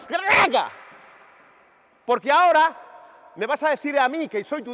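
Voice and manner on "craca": sudden shouted burst of effort; explosive action noise. Shown as a demonstration. Coach shows players the moment to pounce, explosive shouted noise. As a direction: let out a shouted sound for a lunging move.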